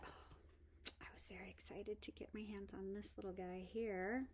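soft thrilled whisper; excited whisper. Real, not acted. Showing a treasured find, excited whisper. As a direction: confide your excitement in a whisper. Admiring new item, whispered.

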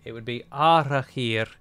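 Arachir said slowly with Elvish sounds; proper Sindarin pronunciation. Shown as a demonstration. Player shows the proper name, Arachir in careful Elvish. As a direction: say "Arachir" carefully, in a native-style way. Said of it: "to pronounce the name properly".

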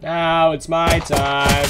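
dramatic slow. Acted. Dramatic catchphrase while gaming.

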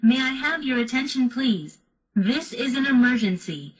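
normal talking voice

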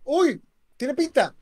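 high, loud gasp of expectation; excited anticipation. Real, not acted. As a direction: react keenly as the card comes. Reacting as the card appears.

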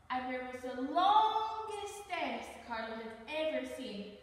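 ordinary delivery, nothing marked

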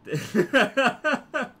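high, loud cackling laugh; real laugh. Real, not acted. Player amused by own build, laughing. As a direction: crack up loudly.

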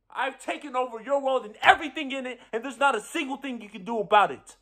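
fast, high-pitched triumphant rant; rapid, worked-up boast of victory; heated gloating. Acted. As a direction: race through it, rubbing the defeat in. Gloating over defeated enemy.

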